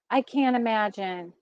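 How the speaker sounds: usual speaking voice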